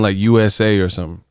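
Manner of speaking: ordinary talk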